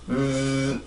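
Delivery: "음" as a long buzzing ð sound; sustained voiced th for "음"; isolated voiced th. Shown as a demonstration. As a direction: hold a low tongue-buzz in place of "음". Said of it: "돼지꼬리 발음의 순수한 소리".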